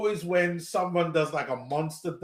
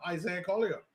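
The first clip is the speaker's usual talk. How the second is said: ordinary delivery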